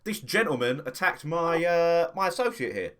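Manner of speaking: pompous inspector. Acted. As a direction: announce yourself with self-important authority. Inspector asserts authority to guards.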